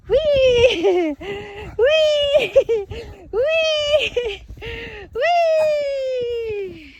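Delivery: very high, loud playful wee; shrieking wee at the running dogs; excited squeals. Real, not acted. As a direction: squeal it with delight as the dogs play. Playing with the dogs in snow.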